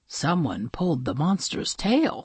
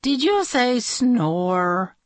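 sleepy; 6 semitones higher, slower than usual. Acted. Very sleepy princess asks.

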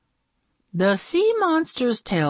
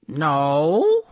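puzzled monster voice; sad sea monster; 2 semitones lower, 3 dB louder than usual. Acted. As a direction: ask like a hurt sea monster. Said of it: "It frowned".

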